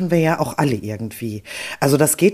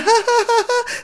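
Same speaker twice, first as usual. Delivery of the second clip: fake laugh. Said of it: "so eine künstliche Lache".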